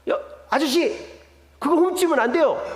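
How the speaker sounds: acted shop worker's sharp warning; store clerk accusing. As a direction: call out a customer for stealing. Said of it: "그 가게 아르바이트가".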